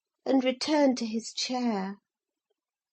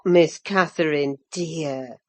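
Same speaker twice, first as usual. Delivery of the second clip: put-on low, older housekeeper's gentle cut-in; Ellen interrupting; 9 semitones lower, slower than usual. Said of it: "I interrupted".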